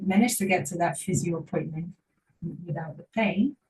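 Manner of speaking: ordinary delivery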